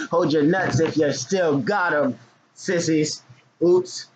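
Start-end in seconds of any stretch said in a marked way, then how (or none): 3.6-4.1 high playful oops; mock oops. Real, not acted. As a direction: fake innocent oops. Playful oops after joke.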